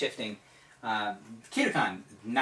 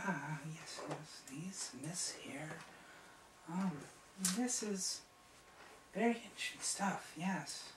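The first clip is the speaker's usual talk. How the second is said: soft, higher, half-whispered; quiet apprentice; 6 semitones higher, 14 dB quieter than usual. Acted. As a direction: ask in a hushed, curious murmur. GM voices Jolien.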